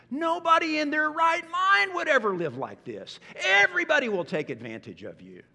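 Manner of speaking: high, loud, disbelieving outburst; hammed-up loud indignant disbelief; incredulous protest. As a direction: push back as if the text is absurd. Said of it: "the emphatic question".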